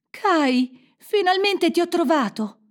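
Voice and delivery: high, overjoyed exclamation; elated high-pitched call; joyful cry. A narrator's reading. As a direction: burst out with relief at finding them. Gerda joyfully finds Kai.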